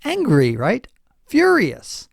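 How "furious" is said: pitched way up, loud "Furious"; high emphatic Furious. Shown as a demonstration. Host defining American mad, exclaiming emphatically. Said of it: "mad means what?".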